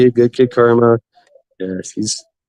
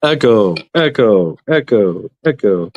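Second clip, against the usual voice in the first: imitated echo; 3 semitones lower, slower than usual. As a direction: trail off like a fading echo. Mimics an echo effect.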